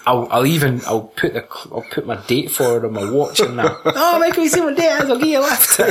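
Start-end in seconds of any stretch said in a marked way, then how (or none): 3.8-5.8 high, sugary teasing voice; high and syrupy, mocking friendliness; sweet mock Laurie. Laurie offers Michael a lift.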